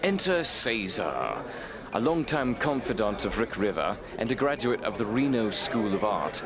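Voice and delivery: normal talking voice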